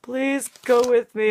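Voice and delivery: normal talking voice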